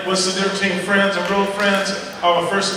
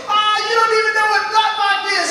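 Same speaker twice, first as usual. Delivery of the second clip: much higher, mocking kid voice; taunting kid; 12 semitones higher than usual. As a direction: tease like a cocky sixth grader. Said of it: "He goes".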